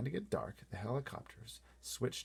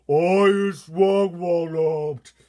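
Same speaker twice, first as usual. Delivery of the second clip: higher, much louder, slow giant bellow; giant's bellow; 9 semitones higher, 16 dB louder, much slower than usual. Acted. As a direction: bellow like an angry giant caught in chains. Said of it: "bellowed the bone cruncher".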